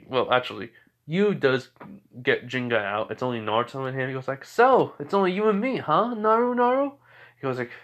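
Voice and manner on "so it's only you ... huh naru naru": high-pitched rival's teasing; playful challenge in a high voice; teasing taunt. Acted. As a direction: needle your rival, pitched right up. Yu taunts his rival in story.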